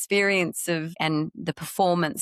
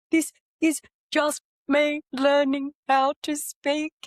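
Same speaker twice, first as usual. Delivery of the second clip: much higher and a bit louder, still tearful; 10 semitones higher, 3 dB louder than usual. Retold or imitated. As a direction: say it high and shaky from crying. Crying mother explains herself to son.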